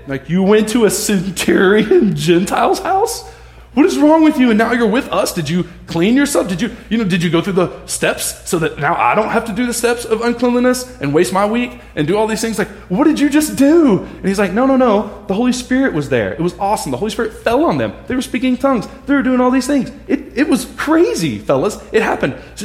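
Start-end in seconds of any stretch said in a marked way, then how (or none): 0.3-14.0 hammed-up outraged elder; mock outrage. Outraged leaders grilling Peter. 14.5-22.4 higher, gushing with wonder; mock-excited Peter. Peter excitedly defends himself.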